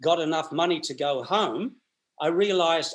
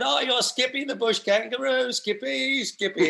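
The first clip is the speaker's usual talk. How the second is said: high teasing Skippy chant; sing-song taunt like cheeky kids; mocking street kids; 8 semitones higher than usual. Kids mocking the Australian newcomer, high sing-song. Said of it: "And they said".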